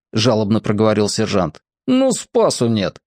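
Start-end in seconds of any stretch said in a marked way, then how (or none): 1.9-3.0 exasperated sergeant. A narrator's reading. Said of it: "и взвизгнул".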